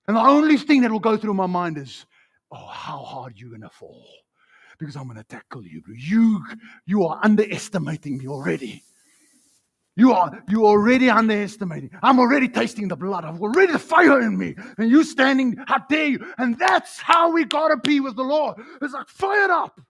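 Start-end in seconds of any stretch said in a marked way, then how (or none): 2.5-5.9 low, quiet menace; hushed, low trash talk before a tackle; warrior's inner threat. Acted. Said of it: "a warrior sound". 6.0-16.3 indignant challenge building higher and louder; rising, fired-up rugby bravado; fighting warrior. Acted. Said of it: "a warrior sound". 16.4-19.8 high, fired-up rallying shout; carried away, high-pitched battle cry; getting excited. Real, not acted. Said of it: "Sorry, I'm getting excited".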